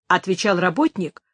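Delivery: usual speaking voice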